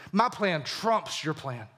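slow deliberate put-down; understated firm emphasis; slow, pointed. Acted. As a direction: spell it out word by word. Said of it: "He says".

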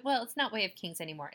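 ordinary talk, nothing marked

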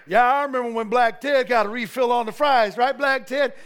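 loud, lightly put-on joking voice; parody voice. Pastor retelling friend's car parody, loud and joking. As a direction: ham up the parody. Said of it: "Lewis is all".

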